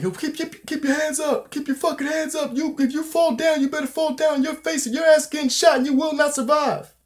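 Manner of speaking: high, strained mock-screaming orders; imitated screaming cop. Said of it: "Kinda, like, screaming at him".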